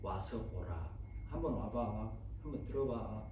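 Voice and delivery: low, soft acted invitation; quietly coax, no persuading; simple invitation. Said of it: "구구절절 설명하고 설득하고 막 비유 맞추고 이러려 하지 않고". (낮고 조용히 권하는 말투)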